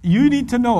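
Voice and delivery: normal talking voice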